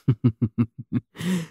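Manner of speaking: teasing laugh. Acted. Teasing laugh at weak grip.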